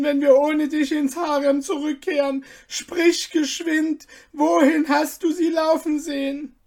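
high, loud, anxious pleading; frantic eunuchs. A narrator's reading. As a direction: beg for news in panic. Eunuchs frantic over lost dog.

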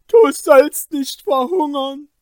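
high, loud, emphatic promise; Kaspar, resolute. Acted. Kaspar answering his starving friend, resolute cry. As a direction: answer firmly at full voice. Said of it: "antwortete Kaspar entschlossen".

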